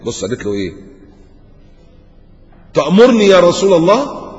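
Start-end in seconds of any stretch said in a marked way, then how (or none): 2.7-4.2 high, loud incredulous question; surprised question. Retold or imitated. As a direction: ask it in disbelief, rising high. Barira questions the Prophet.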